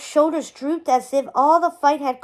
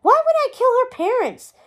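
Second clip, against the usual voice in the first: high, loud indignant outburst; angry; 11 semitones higher, 5 dB louder than usual. A narrator's reading. Merlin accused of killing his friends, indignant. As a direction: sputter in outraged disbelief. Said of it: "sounding angry".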